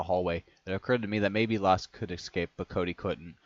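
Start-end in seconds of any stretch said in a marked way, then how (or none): none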